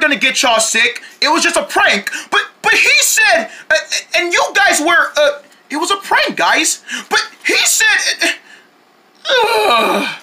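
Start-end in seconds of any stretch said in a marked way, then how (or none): 2.3-10.2 flustered high voice; high confused stammer. Acted. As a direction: sputter in confusion.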